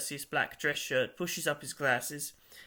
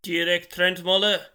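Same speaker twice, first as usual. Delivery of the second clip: loud, slow, mechanical read-out; 4 semitones higher, 9 dB louder, slower than usual. A narrator's reading. Therese reading a name into the phone, mechanical voice. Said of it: "mechanical voice".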